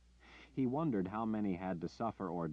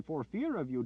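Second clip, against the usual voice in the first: high pleading phrase; villager voice; 9 semitones higher, 4 dB louder than usual. Acted. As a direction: say it plainly as a villager.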